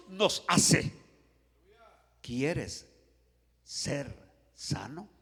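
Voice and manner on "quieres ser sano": softly, word by word, with long pauses; slow gentle question. Acted. Jesus asking the paralytic if he wants healing, slow and low. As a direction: ask it slowly and low, rising at the end.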